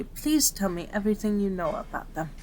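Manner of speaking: usual speaking voice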